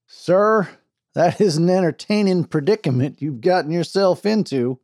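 a wry frontier sheriff, amused. A narrator's reading. Sheriff voiced in story.

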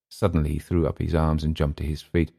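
usual speaking voice

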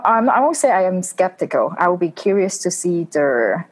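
normal talking voice

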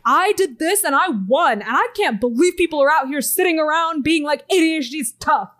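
lightly mock a bragging influencer; mocking a TikToker. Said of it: "saying, like".

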